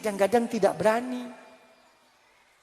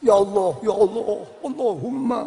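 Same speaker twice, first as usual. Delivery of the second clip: trembling prayer. Retold or imitated. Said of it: "sampai bergetar".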